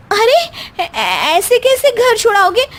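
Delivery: high, half-laughing, half-crying protest; tearful pleading. Acted. Wife pleading with her husband not to send her home, high and tearful. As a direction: whimper that you won't go.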